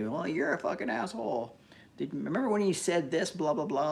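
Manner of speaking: lightly voiced sneering hater; mocking imitation. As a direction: imitate a petty keyboard warrior. Voices online haters nitpicking.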